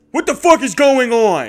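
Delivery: loud, high-pitched outraged yell; angry homeowner's shout. Retold or imitated. Homeowner finding a kid behind his house, furious shout. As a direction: yell it at an intruder.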